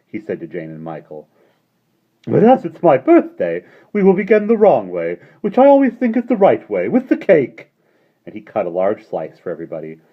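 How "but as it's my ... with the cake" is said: put-on high, loud jolly old man; Mister Wig's voice. A narrator's reading. Mister Wig welcoming the children, high and jolly. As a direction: beam at the children like a merry host.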